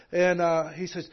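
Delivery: ordinary delivery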